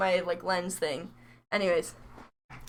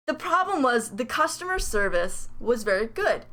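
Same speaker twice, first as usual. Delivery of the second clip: usual speaking voice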